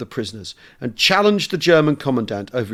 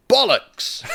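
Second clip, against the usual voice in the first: crisp parade-ground Bollocks, a touch higher; clear, firm Bollocks; 8 semitones higher than usual. A narrator's reading. Sergeant major to German officer, firm clear greeting. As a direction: bark it out with mock conviction. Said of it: "said clearly and with conviction".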